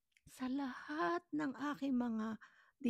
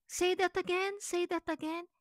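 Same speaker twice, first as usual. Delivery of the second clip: higher, louder mock caller demanding a repeat; puzzled caller; 7 semitones higher, 4 dB louder than usual. New York callers react to her accent, imitated puzzled caller. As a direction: imitate an insistent caller, pitched up. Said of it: "So, pag nagsalita ako".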